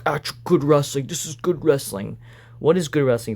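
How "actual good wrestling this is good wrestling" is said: lightly raised, sneering fan imitation; mocking smark voice. A vlogger parodying smug wrestling fans, lightly mocking. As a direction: mimic an indignant know-it-all fan, half-serious. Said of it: "all the smarks will be like".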